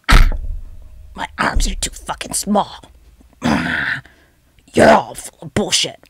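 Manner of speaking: mock monster, strained and half-whispered; little Godzilla. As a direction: growl out a tiny monster's complaint. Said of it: "this is my little Godzilla".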